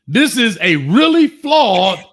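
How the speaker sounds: drawn-out hype delivery; slow announcer demo. Shown as a demonstration. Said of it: "Plug your stuff".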